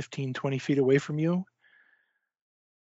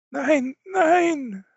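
high whimpering cries of no; frightened German pleading; 14 semitones higher, 4 dB louder than usual. Acted. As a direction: back away pleading. GM voices frightened officer.

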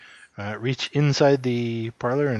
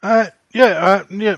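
flustered; 8 semitones higher, 10 dB louder than usual. Acted. Player flustered answering neighbor.

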